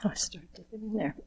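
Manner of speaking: quick whispered aside; hurried whispered aside before the next blessing. Real, not acted. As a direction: whisper it to yourself, fast. Aside while starting next blessing.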